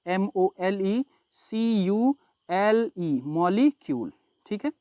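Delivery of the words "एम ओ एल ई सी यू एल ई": एम ओ एल ई सी यू एल ई, each letter slow and separate; teacher slowly spells the word for the class; spelled out slowly. Shown as a demonstration. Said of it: "अंग्रेजी में बोलते हैं मॉलिक्यूल".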